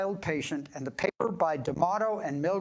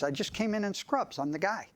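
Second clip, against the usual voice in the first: usual speaking voice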